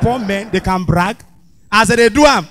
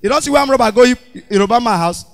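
ordinary talk, nothing marked

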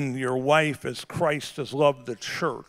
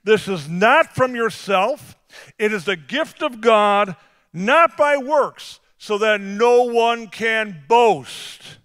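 raised, slow preaching read; scripture reading; 7 semitones higher, 6 dB louder, slower than usual. Acted. Pastor reading scripture to the church, slow and raised. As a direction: proclaim the verse to the congregation.

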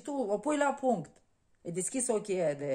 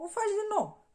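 much higher, emphatic repeat; 13 semitones higher, 5 dB louder than usual. Shown as a demonstration. Repeating the point to the listener, high emphatic lift. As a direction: jump up high on o faci din nou.

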